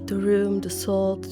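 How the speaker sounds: ordinary delivery, nothing marked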